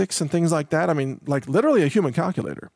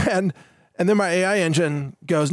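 normal talking voice

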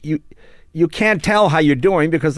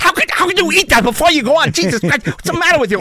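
high, exasperated squawk; aghast, high-pitched mock outrage; appalled Dave; 4 dB louder, faster than usual. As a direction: scold in a hammed-up, very high voice. Acts out Dave appalled by eating.

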